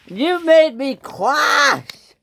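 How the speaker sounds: high, loud, drawn-out accusing yell; mad. A narrator's reading. Percy at the logging locos, mad. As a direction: blame them at the top of your voice. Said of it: "He was mad, red in the face".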